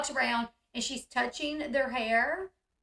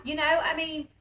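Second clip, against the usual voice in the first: normal talking voice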